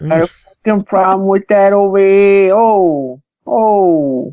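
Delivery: high, stretched-out mock Brooklyn tough guy; hammed-up Brooklyn accent. Author joking back at host's challenge, hammed-up Brooklyn accent. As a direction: overdo a confrontational Brooklyn accent. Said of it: "You're from Brooklyn".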